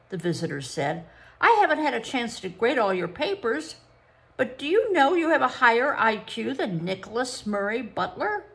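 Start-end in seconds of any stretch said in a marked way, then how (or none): 1.4-8.4 surprised. A narrator's reading. Psychologist amazed.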